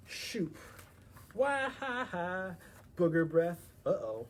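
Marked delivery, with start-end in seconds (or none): 1.3-2.6 slow, high gloating cackle; mock evil laugh. 3.0-3.7 yelled taunt; loud insult. Acted. Mud fight insult.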